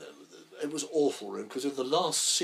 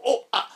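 fight grunts; 13 semitones higher, 12 dB louder than usual. Retold or imitated. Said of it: "and I go".

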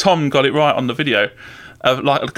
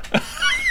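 high squeaky laugh; 3 dB quieter than usual. Real, not acted. Laughs after silly voice.

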